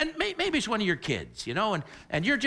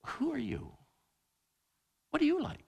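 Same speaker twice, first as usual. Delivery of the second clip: soft question; 7 semitones higher, 5 dB quieter than usual. Retold or imitated. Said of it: "the child says to a parent".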